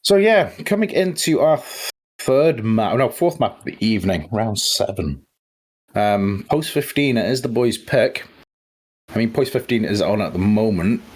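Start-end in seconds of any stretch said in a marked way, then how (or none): none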